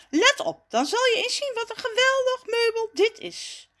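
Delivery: high, showy proclamation; boastful announcing. A narrator's reading. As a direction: show off, sure of yourself. Jacques shows off his chair. (opschepperig aankondigen, met hoge stem)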